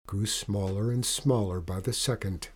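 ordinary delivery

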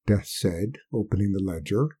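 plain reading voice

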